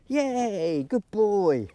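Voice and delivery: high gushing praise; excited woman. Real, not acted. Trainer showing owners how to praise, excited gush. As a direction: fuss over him like an excited woman. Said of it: "Sound like an excited woman".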